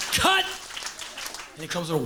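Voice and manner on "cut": high, sharp mock yell; director's angry call. Furious director stops the take.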